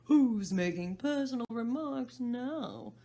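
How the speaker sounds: loud, slow gloating; the hatter scoring a point; triumphant Hatter. Acted. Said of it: "the Hatter said triumphantly".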